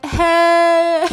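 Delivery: high, wet, teary hey; crying hello. Retold or imitated. Caught crying by her roommate, a high teary hey. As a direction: squeak out a hello through tears. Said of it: "Like, still crying, sobbing".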